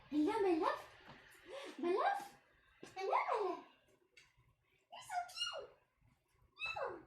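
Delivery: cooing to pet. Real, not acted. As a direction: gush adoringly over your pet in a soft squeal.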